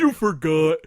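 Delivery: loud mock accusation; teasing you forgot; mock accusing. Real, not acted. Teasing friend who forgot.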